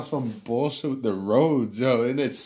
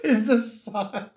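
high, laughing disbelief; 9 semitones higher than usual. Real, not acted. Friend repeats the Sonic joke, high and laughing. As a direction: laugh through the words in disbelief.

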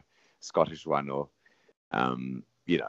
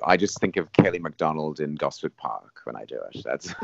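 normal talking voice

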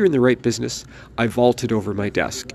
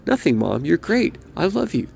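much higher, sing-song mock sweetness; sarcastic reassurance; 6 semitones higher than usual. Son placating his ranting mother with mock sweetness, much higher. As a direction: coo it to shut her up.

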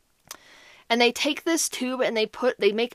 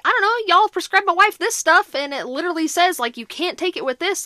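higher, louder exasperated complaint; frustrated quote; 6 semitones higher, 3 dB louder than usual. Retold or imitated. Wife's spouse arguing with the on-call doctor, exasperated. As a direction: push back at the hospital, worked up. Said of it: "She was like".

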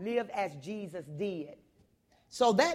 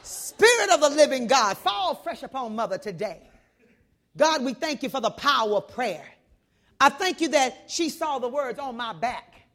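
louder, fervent praying; loud prayer; 7 dB louder than usual. Retold or imitated. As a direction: pray boldly over her. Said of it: "And y'all know I'm loud".

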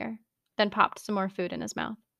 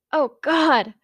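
high, loud gasping cry; aroused cry; 6 semitones higher, 8 dB louder than usual. Acted. Denki on the phone, crying out in pleasure. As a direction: let it burst out, overwhelmed.

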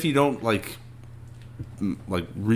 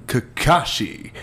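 normal talking voice

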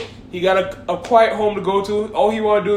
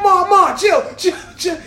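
higher, hurried pleading to mom; panicked pleading; 7 semitones higher than usual. Retold or imitated. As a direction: beg her to calm down. Son begging mother to stop.

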